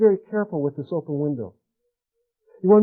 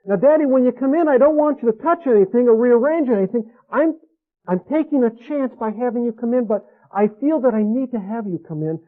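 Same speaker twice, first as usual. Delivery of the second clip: lightly voiced little girl, higher and pleading; child voicing daughter; 6 semitones higher than usual. Daughter warning father not to touch anything, higher. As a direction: plead like a small girl letting daddy in.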